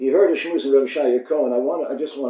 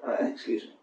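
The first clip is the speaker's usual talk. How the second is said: hushed, breathy excuse me; whispered brush-off; 11 dB quieter than usual. Acted. Bride whispering to the suitor at the door, hushed refusal. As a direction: whisper him away from behind the door.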